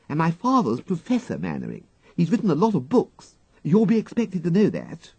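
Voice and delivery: a proud schoolgirl bragging about her father; schoolgirl Peggy. Acted.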